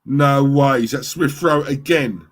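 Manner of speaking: loud, rising goal reaction; loud, thrilled disbelief; excited disbelief. Real, not acted. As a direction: react in amazement as the goal goes in.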